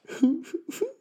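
sudden high cackle of a laugh. Real, not acted. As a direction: burst out in a high giggle. Laughs at own joke.

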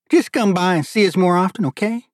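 high, friendly invitation; friendly urging. Acted. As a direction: urge him to visit, pitched well up. Father urges guest to return.

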